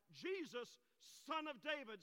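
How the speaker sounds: high, loud preacher's cry for help; acted crying out. Blind man on the roadside to Jesus, acted crying out. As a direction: cry it out slowly and deliberately. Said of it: "he cries and screams out".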